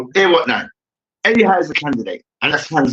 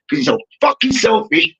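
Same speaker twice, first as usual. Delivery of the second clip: usual speaking voice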